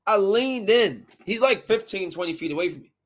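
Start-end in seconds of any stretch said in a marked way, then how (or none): none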